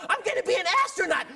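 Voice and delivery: put-on high, fast, loud kid shouting dreams; excited kid. Little kid telling his dream, high and loud. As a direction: blurt it like an eager child.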